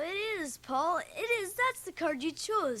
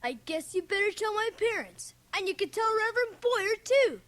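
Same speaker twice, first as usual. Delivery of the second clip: rising higher and louder in defiance; boy defying the minister, growing louder; defiant; 7 semitones higher, 4 dB louder than usual. Acted. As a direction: throw it back at him.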